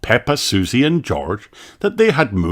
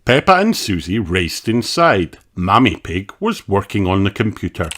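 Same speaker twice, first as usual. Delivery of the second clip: usual speaking voice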